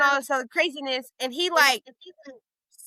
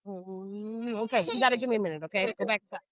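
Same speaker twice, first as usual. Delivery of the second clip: soft parental reassurance; 4 semitones lower, 8 dB quieter than usual. Real, not acted. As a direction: gently send a little one off, quietly. Interrupts story to soothe a child.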